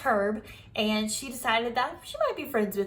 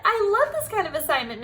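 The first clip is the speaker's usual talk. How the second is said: higher, louder and fast with delight; thrilled; 8 semitones higher, 5 dB louder, faster than usual. Acted. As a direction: gush to the teacher. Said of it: "May squealed, very thrilled".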